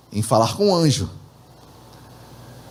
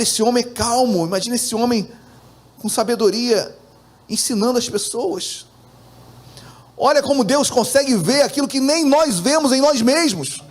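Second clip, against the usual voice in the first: ordinary delivery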